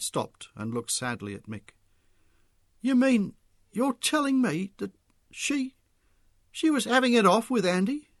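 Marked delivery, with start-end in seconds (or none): 2.8-8.1 high, louder incredulous question; falter, then blurt it in disbelief; halting shock, pitch far up; shocked realisation. A narrator's reading. Mick realises his wife's affair.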